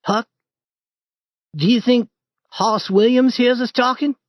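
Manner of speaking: put-on high boyish voice, uneasy; a scared boy asking in the dark; young Tom. Tom asking Huck at the new grave, high nervous boy.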